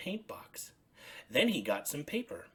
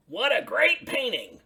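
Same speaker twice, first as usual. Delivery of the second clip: higher, louder friendly Bert; 12 semitones higher, 11 dB louder than usual. Acted. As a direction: voice Bert lifted up high. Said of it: "he said".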